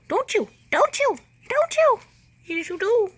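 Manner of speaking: very high, squeaky teasing. Real, not acted. Baby talk to her animal.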